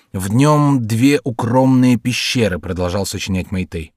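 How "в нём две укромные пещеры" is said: slow, raised declamation; mock-solemn verse. Meitei improvises an epigram on a nose, mock-solemn declaiming. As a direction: declaim it slowly, drawing the words out.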